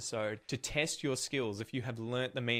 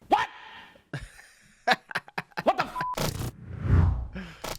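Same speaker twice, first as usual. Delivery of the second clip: drawn-out high shocked what the; stunned disbelief; 6 dB louder, much slower than usual. Real, not acted. Viewer reacting to the slang sentence, drawn-out stunned cry. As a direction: voice stretching up in disbelief.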